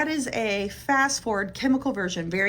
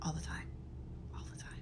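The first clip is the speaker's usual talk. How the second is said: fading whisper; 4 semitones lower, 16 dB quieter, faster than usual. Real, not acted. Fading repetition.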